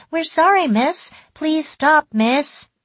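high-pitched pupils' plea; begging children. A narrator's reading. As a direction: beg the teacher like frightened kids. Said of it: "everyone started begging".

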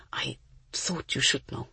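soft distant whisper. A narrator's reading. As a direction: whisper it as if from afar. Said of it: "voice sounded as though it were coming from far away".

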